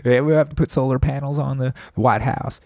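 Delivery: mock genteel Southern politician; Carter impression. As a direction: fix the accent back to a genteel Southern president. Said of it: "I think I'm changing".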